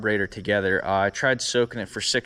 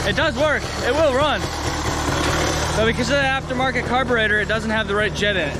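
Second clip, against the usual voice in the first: excited; 11 semitones higher, 3 dB louder, faster than usual. Real, not acted. Bike finally starts after long effort.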